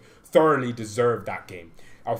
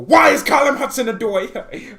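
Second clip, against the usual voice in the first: much higher and louder, baffled; incredulous outburst; 9 semitones higher, 9 dB louder than usual. Real, not acted. Fan baffled by a rival winger, loud incredulous shout. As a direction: throw the question up in disbelief.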